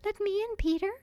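high, tinkling fairy voice; lovely little fairy, pitched far up; bell-like voice. A narrator's reading. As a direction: ring it out like a tiny bell. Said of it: "in a lovely bell-like voice".

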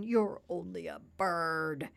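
mildly whining pig; understated tearful complaint. A narrator's reading. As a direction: whine it dismissively, a touch tearful. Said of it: "beginning to cry again".

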